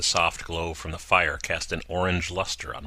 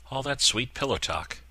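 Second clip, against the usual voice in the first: sarcastic; 7 semitones higher than usual. Acted. Craig teasing sarcastically.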